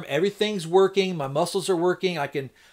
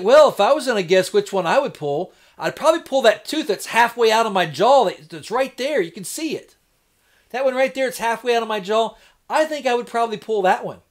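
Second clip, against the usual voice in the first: higher, emphatic mock-obvious answer; exaggerated retold tone; 6 semitones higher, 5 dB louder than usual. Patient pointing out the tooth to pull on the X-ray, exaggerated tone. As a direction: play up the obvious, pitched up. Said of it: "Maybe not in that tone of voice".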